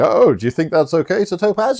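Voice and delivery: high, put-on questioning voice; Fester. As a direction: ask in a lightly hammed jester voice. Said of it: "Fester's like".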